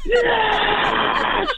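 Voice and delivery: very high, strained mock scream of triumph; high jubilant yell, held back in volume; top-of-lungs yell. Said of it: "I yelled at the top of my lungs".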